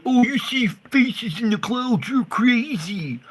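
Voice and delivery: high, loud, slow taunting voice; mock skeptic. Mocking people who call him crazy, taunting. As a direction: sneer like they think you're mad. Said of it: "look at me going".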